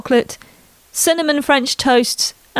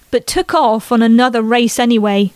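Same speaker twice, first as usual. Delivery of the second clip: usual speaking voice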